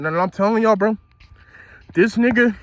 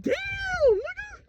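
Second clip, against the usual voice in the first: much higher, softer pained squeak; squeaky shock; 6 dB quieter than usual. Retold or imitated. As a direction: let it squeak out, stunned. Retells being slammed into wall.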